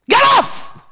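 high, loud, slow rebuke; reenacted scream at the spirit; shouted command. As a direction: yell it at the demon with full force. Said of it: "the more I shouted".

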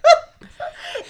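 high loud helpless laughter; laughing. Real, not acted. Bride laughing hard while recounting the fire. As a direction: crack up telling it. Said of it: "laughing at disbelief".

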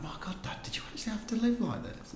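high breathless whisper; hushed, shocked child; daughter's whisper. Retold or imitated. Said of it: "She's like".

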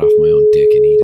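fast, loud rant over the ringtone. Real, not acted. Prank caller ranting over a blaring ringtone, loud and fast.

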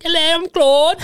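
mock falsetto foreigner introducing himself; high-pitched French Canadian. Podcaster imagines Claude introducing himself, silly high-pitched French Canadian voice. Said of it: "talks in that really high pitched French Canadian voice".

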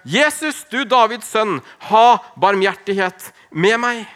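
high, loud plea for mercy; calling out. A narrator's reading. Blind beggar by the road crying out to Jesus, loud. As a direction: cry out to Jesus, begging. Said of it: "satte han i å rope".

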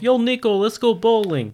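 high, excited phone call from a cousin; game cousin voice. Retold or imitated.

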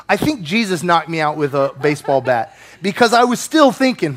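ordinary talk

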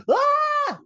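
short high mock scream. Retelling the girl's scream in the film. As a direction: shriek it, tongue in cheek. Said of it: "the girl who's there goes".

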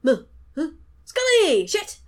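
mock startled yell, high and loud; Mulder waking up. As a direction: jolt awake shouting, hammed-up. Acts out Mulder waking after gunshot.

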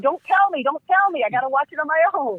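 high, fast "don't tell me" plea; mock-panicked high plea, hands over ears; pleading. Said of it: "I said".

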